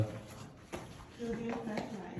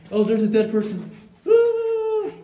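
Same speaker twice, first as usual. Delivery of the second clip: fake fright; 9 semitones higher, 7 dB louder than usual. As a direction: pretend scared shriek. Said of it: "just kidding".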